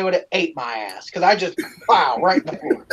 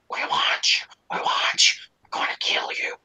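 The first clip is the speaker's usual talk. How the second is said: menacing ghostly whisper; creepy whisper; 6 dB quieter than usual. Retold or imitated. Hidden voice threatening the little boy, whispered. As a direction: hiss the threat from the darkness. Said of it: "it's getting louder".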